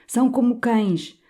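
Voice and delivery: spat out between clenched teeth; spat through teeth. A narrator's reading. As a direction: hiss it through the teeth with venom. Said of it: "soltou ela por entre os dentes". (entre dentes, com raiva)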